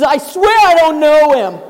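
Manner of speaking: loud insistent swear; desperate denial. Acted. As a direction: shout it down. Peter's final loud denial.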